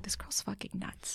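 slow, very soft whisper; whispered judgement. Retold or imitated. Sorority peers judging the new girl, gossipy whisper. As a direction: whisper it like gossip behind someone's back. Others' judgement of her, retold.

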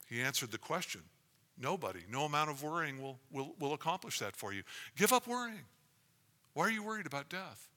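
fervent full-voiced sermon; preaching.

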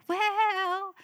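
high, drawn-out hedging; sheepish stalling. Retold or imitated. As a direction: stretch it high, avoiding the answer. Retold sheepish stalling to sister.

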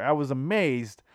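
ordinary talk

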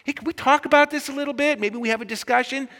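high, fast, loud nervous pleading; freaking out. Acted. Ghost bargaining with the angel, panicky. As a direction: stall for time in a panicky high voice. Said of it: "the ghost kind of freaks out".

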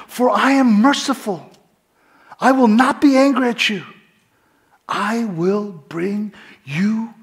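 slow, solemn voice of God, dropping to a whisper at the end; scripture reading. Acted. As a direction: speak the promise slowly and tenderly. Reads God's words from scripture.

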